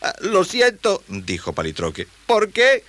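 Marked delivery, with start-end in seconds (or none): 0.0-1.0 put-on high, slow boy voice; high-pitched, sorry youngster; sympathetic boy. Boy sympathises with sad creature. 2.3-2.8 very high, loud question; high-pitched, puzzled youngster; concerned boy. Acted. Boy asks creature about sadness.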